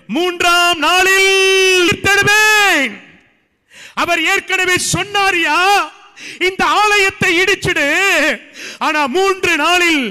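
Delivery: high, loud preaching cry; full-force preaching. Acted. Pastor proclaiming the promise to the church, loud and high. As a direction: thunder it out to the congregation.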